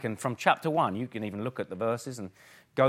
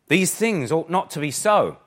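emphatic; 4 semitones higher, 8 dB louder than usual. Retold or imitated. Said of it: "So James emphatically says".

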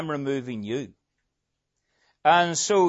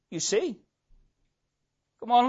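usual speaking voice

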